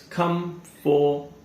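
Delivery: COMFORTABLE broken into "come, for, ta, bull"; COMFORTABLE chopped up with pauses, full "for"; four-syllable mispronunciation. Shown as a demonstration. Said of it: "there are four syllables".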